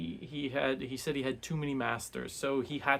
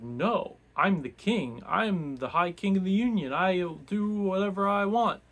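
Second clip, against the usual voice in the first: higher, louder, indignant royal shout; defiant outburst; 8 semitones higher, 7 dB louder than usual. Retold or imitated. Young king defying the wizard who controls him, indignant shout. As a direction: insist you're the king and nobody rules you. Jezal defies Bayaz as king.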